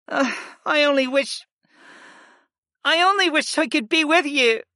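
crying. Acted. Erin wishes she could go with Griff, breaking into tears. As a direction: let the wish crack on a sob.

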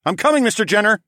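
loud, high reassuring call; loud, high call down the corridor; calling back. Acted. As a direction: shout ahead while running to him. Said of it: "I called back".

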